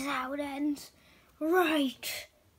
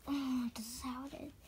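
despairing whisper; 8 dB quieter than usual. Acted. Pony despairs in defeat.

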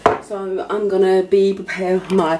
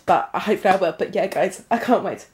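ordinary delivery

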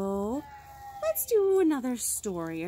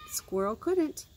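plain reading voice

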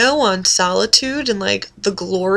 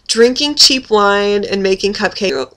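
ordinary talk

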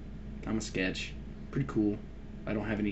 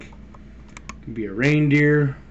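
usual speaking voice

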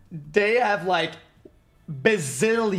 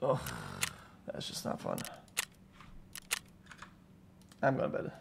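deflated; 7 semitones lower, 13 dB quieter than usual. Real, not acted. Disappointed after a cheater kill.

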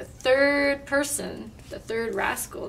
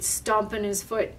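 plain reading voice

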